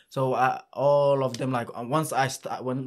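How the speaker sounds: ordinary talk, nothing marked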